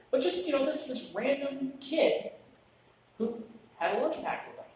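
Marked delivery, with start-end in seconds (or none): none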